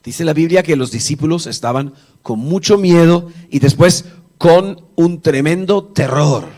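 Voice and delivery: ordinary talk, nothing marked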